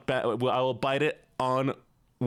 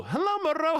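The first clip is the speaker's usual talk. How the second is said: Moreau greeted in a much higher voice; high sing-song. Shown as a demonstration. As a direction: lift the whole greeting up high and playful.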